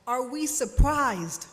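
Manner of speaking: usual speaking voice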